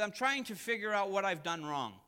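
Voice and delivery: a worried man confessing to his pastor; sincere worry. Retold or imitated. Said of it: "very sincere".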